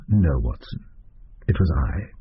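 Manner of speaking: low, measured detective voice; Holmes. Acted. Holmes answers Watson.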